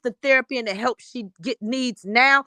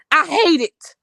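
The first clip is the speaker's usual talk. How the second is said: emphatic outburst; 4 semitones higher, 5 dB louder, slower than usual. Real, not acted. Upset about the kids.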